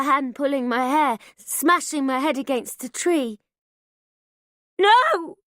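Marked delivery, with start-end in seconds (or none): 4.8-5.4 very high loud scream; cry of pain. A narrator's reading. Daughter screaming as her head hits a tree. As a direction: scream as you're hurt.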